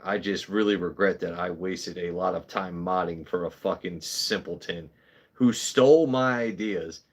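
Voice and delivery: usual speaking voice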